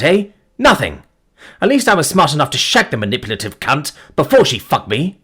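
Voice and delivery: bitter, crude, loud-ish rant; furious tirade. Acted. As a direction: hurl the insults.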